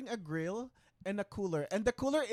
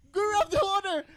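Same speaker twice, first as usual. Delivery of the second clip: high-pitched, laughing imitation of panic; hammed-up urgent orders, breaking into laughter; mock frantic command; 10 semitones higher than usual. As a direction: imitate someone overreacting, pitch way up. Reenacting friend's overreaction, laughing.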